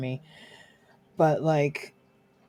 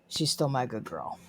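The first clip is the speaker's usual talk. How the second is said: ordinary talk, nothing marked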